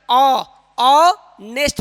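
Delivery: slow, loud "ऑ-, ऑनेस्ट" with the first vowel held; silent H, drawn out. Shown as a demonstration. Teacher drilling students, "ऑ-, ऑनेस्ट" vowel stretched out. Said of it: "ऑनेस्ट पढ़ेंगे".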